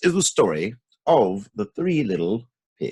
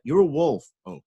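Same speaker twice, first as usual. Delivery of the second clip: plain reading voice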